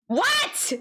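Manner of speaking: very high, rising "what"; incredulous squeal. Acted. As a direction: shoot the pitch up in disbelief. Last and highest of repeated whats.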